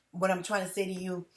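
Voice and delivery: normal talking voice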